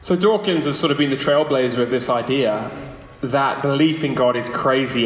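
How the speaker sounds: ordinary talk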